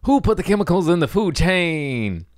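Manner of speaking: high, bright cartoon singsong; Disney voice. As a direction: sing it like a Disney character. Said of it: "a Disney voice".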